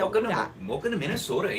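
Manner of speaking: put-on high, quick Minnesota accent; a mock Fargo welcome in dialect. Welcoming a viewer from Minnesota, put-on local accent. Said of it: "my whole input on that accent".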